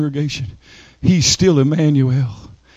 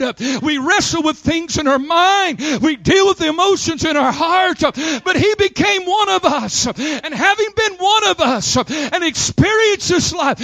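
high, impassioned preacher cadence; full-force preaching; 11 semitones higher than usual. Real, not acted. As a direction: preach it high, phrase by driving phrase.

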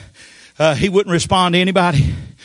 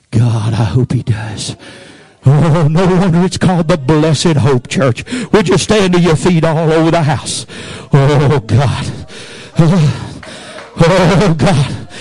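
loud, slow preacher's cry; preacher shouting to the church to stand and pray; full-force preaching; 5 dB louder, slower than usual. Real, not acted. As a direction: rise to a shouting altar call. Preaching climax, calls congregation up.

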